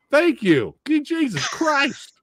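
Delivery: high, drawn-out exasperated cry; mock exasperation. Host ranting that nobody praises the actress, high exasperation. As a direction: stretch it out high in disbelief. Exasperated end of beauty rant.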